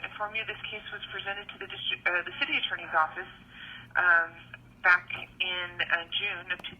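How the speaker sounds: recorded phone message; leaving a voicemail. Real, not acted. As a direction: voicemail over a phone line. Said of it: "here's a phone call I received".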